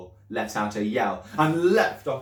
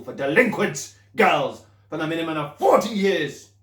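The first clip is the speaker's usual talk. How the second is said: higher, louder tirade; furious headmistress rant; 5 semitones higher, 6 dB louder than usual. Acted. Headmistress threatening the pupil with punishments.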